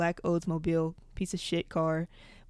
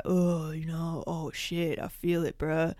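faking being high; slower than usual. Retold or imitated. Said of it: "trying to act like I was high".

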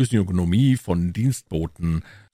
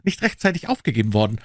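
high-pitched, apologetic lord; entschuldigender Lord; 6 semitones higher than usual. Acted. (hohe, entschuldigende Lordstimme)

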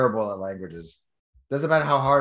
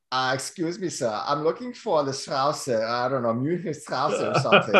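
put-on German accent, mock polite. Asking a random German for a street, fake German accent. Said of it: "I started speaking in a German accent".

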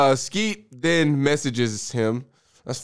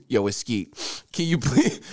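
Skeet voice; slower than usual. Pretend.

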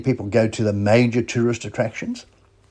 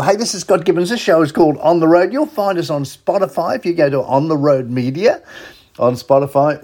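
bright, loud station ID, higher up; announcer read; 5 semitones higher, 5 dB louder than usual. Acted. Radio host reading the show's station ID, announcer style. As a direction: sell the show like a radio promo.

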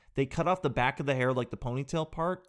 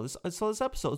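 normal talking voice

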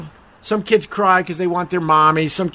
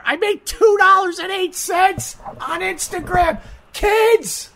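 higher, put-on whiny voice; mock whiny; 13 semitones higher, slower than usual. Streamer mocking his Instagram pay, whiny put-on voice. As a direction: pretend to be outraged at the payout. Mock outrage at tiny earnings.

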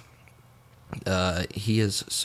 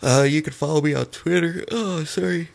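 talking through a yawn; words stretched by a yawn; 7 semitones higher, 4 dB louder than usual. Real, not acted. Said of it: "I'm yawning".

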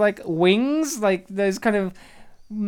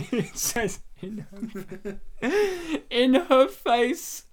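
laughing through the words, slowing at the end; can barely get it out for laughing; 3 semitones higher, slower than usual. Real, not acted. Laughing while reading a bad line.

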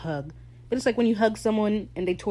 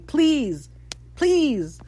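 hammed-up pleading, rising higher; begging; 8 semitones higher, 5 dB louder than usual. Fan begging for flashbacks, playfully desperate pleading. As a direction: beg over and over, each please high and falling. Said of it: "I'm on my knees begging".

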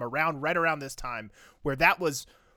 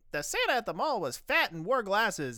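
put-on kid voice; 8 semitones higher than usual. Kid voiced in retold story.

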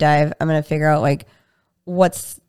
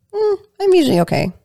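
higher and fast, a lightly voiced dodging patient; mock evasive patient; 12 semitones higher, 3 dB louder, faster than usual. Healer imitating a patient dodging the question, quick and high. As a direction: brush it off quickly, voice pitched up. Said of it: "So if I ask a patient".

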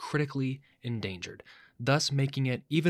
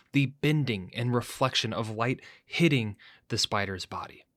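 ordinary delivery

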